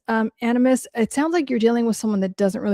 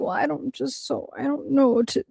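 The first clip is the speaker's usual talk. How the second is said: higher, softer mock-helpless fumbling; feigned helplessness; 4 semitones higher, 4 dB quieter than usual. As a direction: act lost and helpless in a small high voice. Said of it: "this whole learned helplessness".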